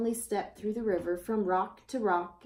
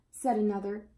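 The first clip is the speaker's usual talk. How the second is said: usual speaking voice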